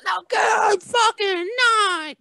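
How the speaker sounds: high, slow, strained rock screech. Retold or imitated. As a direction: stretch it into a wailing metal scream. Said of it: "that sounded like a dying [name]".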